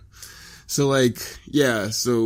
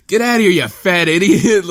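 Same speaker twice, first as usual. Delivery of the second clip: loud, raised scolding voice; imitated exasperated yell; mock angry dad; 8 semitones higher, 5 dB louder than usual. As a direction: chase him off like a fed-up dad. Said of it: "Choji's dad's like".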